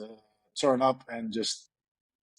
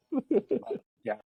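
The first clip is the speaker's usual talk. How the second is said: a mock Dutch accent in English, high-pitched; funny Dutch accent; 14 semitones higher than usual. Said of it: "find my accent a little bit funny".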